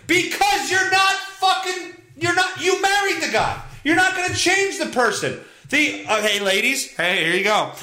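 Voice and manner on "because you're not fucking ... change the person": high, exasperated rant; exasperated scolding. Real, not acted. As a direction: tell them off, pitch jumping up. Scolds the article's writer.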